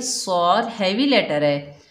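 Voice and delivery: ordinary delivery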